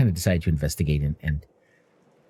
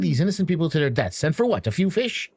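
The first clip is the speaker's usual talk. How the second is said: higher-pitched, reproachful questions; indignant scolding; 8 semitones higher than usual. Acted. Half-elf accuses dwarf of endangering innocents, indignant. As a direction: take him to task, pitched up.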